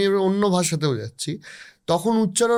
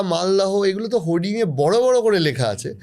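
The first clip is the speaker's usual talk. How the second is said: ordinary talk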